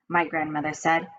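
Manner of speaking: ordinary delivery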